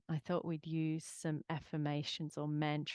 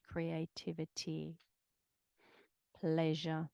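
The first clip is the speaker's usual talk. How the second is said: guided meditation. Acted.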